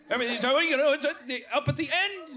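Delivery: everything's a question with Aussie upspeak; high, laughing Aussie lilt; Australian rising tune. Shown as a demonstration. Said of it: "Everything's gotta be a question".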